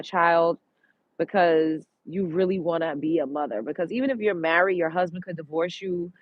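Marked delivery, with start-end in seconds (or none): none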